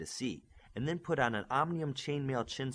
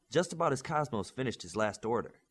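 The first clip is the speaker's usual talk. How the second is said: plain reading voice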